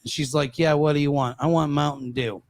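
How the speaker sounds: ordinary delivery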